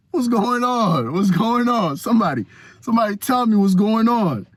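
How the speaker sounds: high-pitched, hammed-up disbelief; mock bewilderment. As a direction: plead comically for someone to explain. Acted bewilderment at sudden attention.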